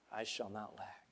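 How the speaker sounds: I shall not lack, said low and soft; hushed declaration. Shown as a demonstration. Pastor restating the psalm in a hushed, reverent voice. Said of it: "quiet solemn restatement".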